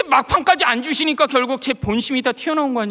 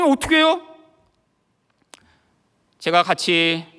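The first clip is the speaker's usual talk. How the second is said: ordinary talk, nothing marked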